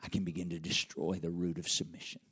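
low, hushed, plotting voice; sinister scheming devil. Retold or imitated. Preacher voicing the devil plotting against homes, hushed and sinister. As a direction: let the adversary whisper his plan. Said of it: "He says".

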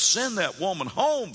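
high-pitched exasperated demand; acted exasperation. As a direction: play a fed-up disciple insisting. Disciples voiced demanding she leave.

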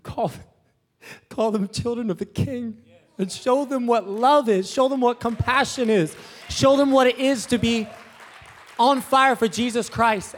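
high, loud, rising preacher's call; full-force preaching. Acted. Young pastor firing up the congregation, full-force preaching. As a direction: preach it out big to the church.

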